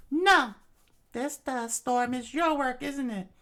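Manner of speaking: very high falsetto accusation, loud opening; defiant princess. Acted. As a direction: confront the villain in falsetto. Princess accusing Sir Crocodile.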